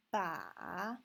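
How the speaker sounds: drawn-out falling-rising "ba"; third tone. Shown as a demonstration. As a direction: take "ba" down into a valley and up. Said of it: "one, two, three".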